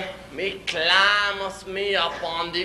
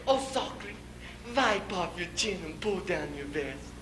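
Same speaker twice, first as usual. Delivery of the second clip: lightly voiced wife, softer; wife's retort; 8 dB quieter than usual. Wife teasing stuck husband, soft retort. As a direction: drop to a softer wifely voice.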